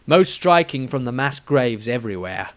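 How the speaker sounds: usual speaking voice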